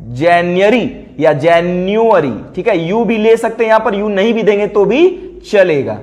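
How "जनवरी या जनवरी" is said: जनवरी या जनवरी drawn out slowly, jen-yu-eri; slow pronunciation demo. Shown as a demonstration. Teacher slowly models जनवरी for students. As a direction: spell out जनवरी syllable by syllable, very slowly. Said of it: "देखिए जैन्य".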